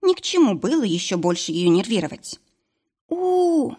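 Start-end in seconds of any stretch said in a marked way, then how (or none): 3.1-3.7 high wail of labor pain; pained howl. Acted. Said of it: "Мари взвыла".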